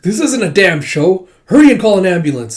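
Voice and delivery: loud, fast shouted order; urgent anger. Retold or imitated. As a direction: demand help right now. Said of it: "It's like".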